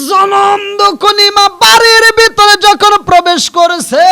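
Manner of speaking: loud, high chanted climax; full-force preaching. A narrator's reading. Preacher tells of mother entering, loud high chant. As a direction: belt out the narration at the top of the voice.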